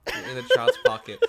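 squealing high through laughter; cracking up while repeating it; laughing. Real, not acted. Echoing with a laugh.

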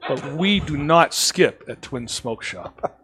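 slow, deliberate laying down of the rule; gently but firmly, very slow. Retold or imitated. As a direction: spell out the shop rule slowly. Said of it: "I sit her down and I'm like".

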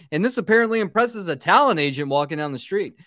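ordinary talk

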